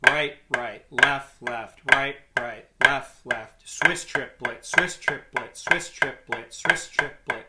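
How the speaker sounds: steady metronomic chant of drum strokes; calling in rhythm. Shown as a demonstration. Drum teacher calling doubles and Swiss triplets while playing, in rhythm. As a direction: call each rudiment evenly on the beat.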